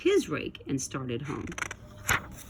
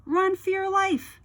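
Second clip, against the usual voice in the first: high frantic warning, pitched way up; panicked cry; 13 semitones higher, 3 dB louder than usual. A narrator's reading. Rabbit warning the woods, panicked high cry. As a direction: yelp it out like a terrified rabbit. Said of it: "shouted a rabbit".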